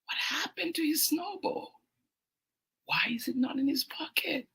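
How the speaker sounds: high, whispery, puzzled questions; small, soft, high-pitched worry; hushed wondering. A narrator's reading. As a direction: ask it softly, in sad bewilderment. Voices boy's worry over lost snowball.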